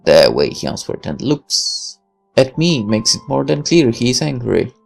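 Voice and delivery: ordinary talk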